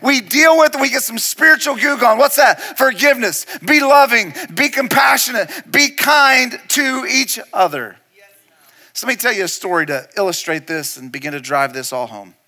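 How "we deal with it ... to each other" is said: high, loud, urgent exhortation; full-force preaching. Real, not acted. Preacher urging the congregation to forgive, full-force preaching. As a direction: punch out each command with rising force.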